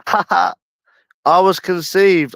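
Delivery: laughed out slowly through giggles; giggling, drawn-out and pitched high; laughing. Real, not acted. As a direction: stretch it out while cracking up. Laughing while announcing birth.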